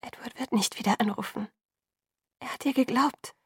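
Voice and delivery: hushed, careful bad news; whispered. A narrator's reading. Alice breaking the news to Bella, whispered. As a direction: break it to her in a half-whisper. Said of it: "flüsterte Alice".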